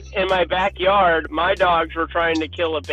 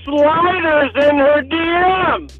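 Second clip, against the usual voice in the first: louder, higher call across the house; 7 semitones higher, 4 dB louder, slower than usual. Real, not acted. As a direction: call out to the next room. Calling out to wife in another room.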